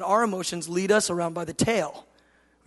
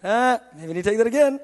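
louder, higher, rising at the end; God shrugging off a test; 4 semitones higher, 6 dB louder than usual. Retold or imitated. God to a failed test-taker, loud and rising. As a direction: toss out the retake as a loud suggestion. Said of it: "He goes".

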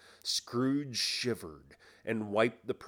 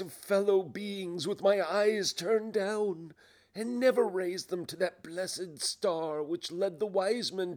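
higher, pleading lament; tormented ghost; 11 semitones higher, 5 dB louder than usual. Acted. Ghost regrets ignoring the poor, lamenting. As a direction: ask it as an anguished self-reproach. Ghost questions its past life.

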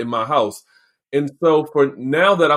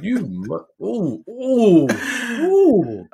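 biting tongue oohs; 6 semitones higher, much slower than usual. Retold or imitated. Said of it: "I be like".

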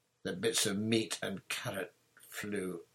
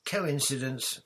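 higher, light dismissal; airy; 6 semitones higher, 3 dB louder than usual. A narrator's reading. Hermione dismissing the uncle's death, airy. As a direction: toss it off airily. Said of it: "said Hermione airily".

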